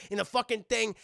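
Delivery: ordinary talk, nothing marked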